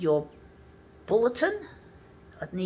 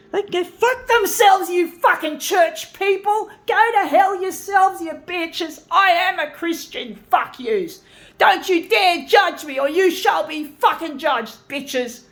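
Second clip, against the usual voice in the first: high, loud, furious screaming; furious yelling; 8 semitones higher, 7 dB louder than usual. Real, not acted. Ranting at judgmental church people, furious shouting. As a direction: yell it out at the people who judged you. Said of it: "I am really angry at my fucking fellow Christians".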